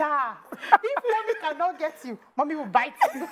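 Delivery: high, slow words through laughter; quoting her son while laughing; mother laughing as she quotes her son. Retold or imitated. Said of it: "he said".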